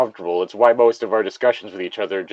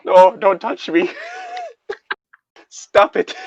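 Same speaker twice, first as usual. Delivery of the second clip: laughing protest; 11 semitones higher, slower than usual. Real, not acted.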